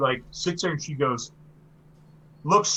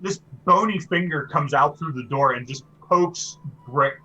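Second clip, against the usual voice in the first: normal talking voice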